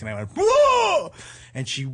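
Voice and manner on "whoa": high, loud jump-out holler; reenacted monster-style shout; mock scare yell. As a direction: leap out and spook someone. Acts out scare in mask.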